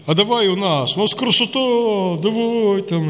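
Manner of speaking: hammed-up deacon, stretching the vowels as he entices; old-school protodeacon. Protodeacon inviting a newcomer to stay, hammed-up old-school churchman. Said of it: "протодиакон старой школы".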